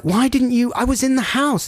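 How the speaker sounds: reliving the phone row, voice climbing; retold anger. Scolding the installer in high retold irritation. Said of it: "my blood is boiling".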